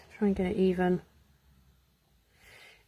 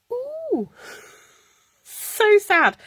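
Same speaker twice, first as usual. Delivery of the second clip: excited squeal; 13 semitones higher, 6 dB louder, much slower than usual. Real, not acted. Pleased squeal at result.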